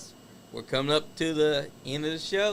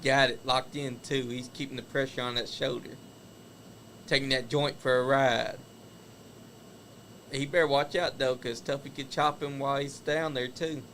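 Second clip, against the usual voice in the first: live play-by-play wrestling call; wrestling commentator. Acted. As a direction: call the match as it happens. Calls the wrestling match live.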